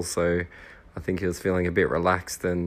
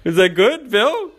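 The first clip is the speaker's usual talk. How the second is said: high, loud and delighted; excited teasing; 10 dB louder than usual. Real, not acted. Friend teasing Bill after the recording, high and gleeful. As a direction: gleefully ask Bill for approval.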